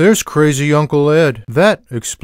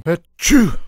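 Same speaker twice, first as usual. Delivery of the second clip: mock sneeze; 4 semitones higher than usual. Sneeze sound.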